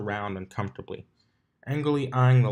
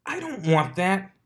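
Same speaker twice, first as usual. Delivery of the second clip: higher, louder flustered denial; flustered protest; 10 semitones higher, 4 dB louder than usual. Acted. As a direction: protest too quickly, rattled.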